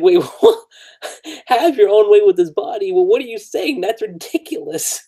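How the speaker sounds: high, mock-scandalized protest; retold incredulous outburst; imitated freak-out. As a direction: act out flustered disbelief. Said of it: "They freaking out".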